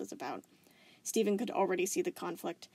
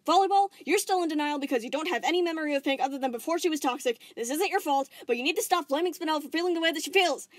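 higher, louder, fast urging; urgent lecture; 8 semitones higher, 8 dB louder than usual. Acted. Steven urging Volleyball to stop blaming Spinel, earnest. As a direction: talk sense into her quickly.

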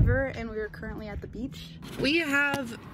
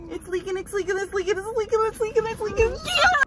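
building higher and higher; rising panic; 10 semitones higher, faster than usual. Real, not acted. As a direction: panic more with each repeat. Popsicle leaking, panic.

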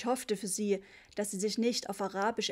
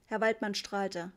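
plain reading voice

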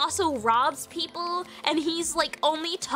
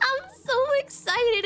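excited squeal; 10 semitones higher than usual. Real, not acted. Telling viewers about the Wonderful Life remake, squealing excitedly. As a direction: burst with joy.

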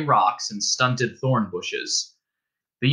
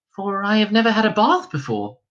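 a hint of a weary young girl, higher; lightly voiced Star; 9 semitones higher than usual. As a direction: ask for help, tired and hurt. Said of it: "said the Star".

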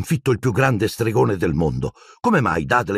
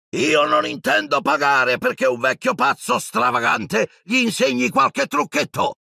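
a much higher, furious uncle shout; 14 semitones higher than usual. A narrator's reading. Uncle screaming at the giant about the headmaster, high and furious. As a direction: yell it in a high outraged voice. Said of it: "urlò zio Vernon".

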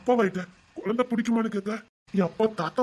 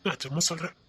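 whispered promise; 4 semitones lower, 6 dB quieter than usual. Acted. Tender promise to pregnant wife.

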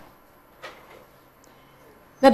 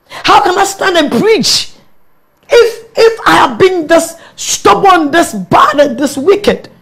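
slow, pleading through tears, higher and louder; tearful pleading; 8 semitones higher, 8 dB louder, much slower than usual. Real, not acted. A pastor confessing her stubbornness to the audience, through tears. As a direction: let the voice break as she confesses. Emotional testimony about stubbornness.

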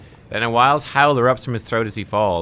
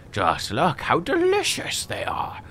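whisper; 6 semitones higher, 3 dB quieter, slower than usual. Acted. Merchant shows off his wares.

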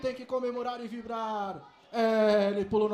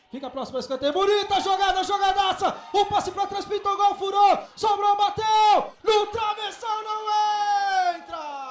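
excited play-by-play; 4 semitones higher, 4 dB louder than usual. Real, not acted. Near goal, hits the crossbar.

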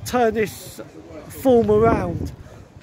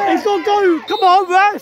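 raised, pleading appeal; pleading with the referee; 8 semitones higher, 3 dB louder than usual. Real, not acted. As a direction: beg the referee for the call.

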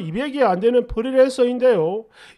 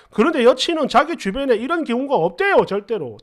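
usual speaking voice

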